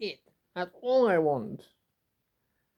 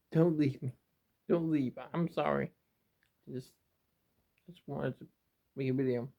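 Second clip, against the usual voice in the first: normal talking voice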